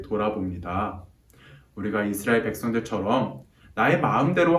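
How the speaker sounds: soft, even-paced prayer voice; spoken prayer. Real, not acted. Preacher prays to God on behalf of the children, hushed prayer. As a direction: pray earnestly and quietly to God.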